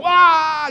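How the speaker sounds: loud, high startled scream; scared scream. Retold or imitated. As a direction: yelp as if someone just yelled at you. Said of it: "You know when you're scared?".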